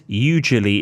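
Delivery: hugely said as "you-julie"; hugely in three syllables with no h; mam's mispronunciation, mocking. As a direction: slip in hugely without the h, as "yoo-juh-lee". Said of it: "my mam mispronounces so many words".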